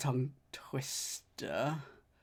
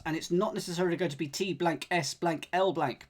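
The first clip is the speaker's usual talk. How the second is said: normal talking voice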